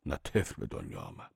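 ordinary delivery

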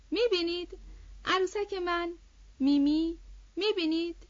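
put-on high, loud child voice in broken bursts; excited little girl. A narrator's reading. Little girl showing off her doll, excited broken phrases.

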